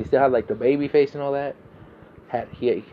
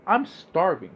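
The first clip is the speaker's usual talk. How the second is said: normal talking voice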